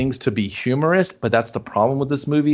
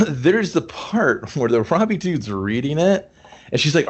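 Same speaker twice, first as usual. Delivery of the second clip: ordinary talk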